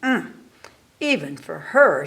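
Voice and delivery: usual speaking voice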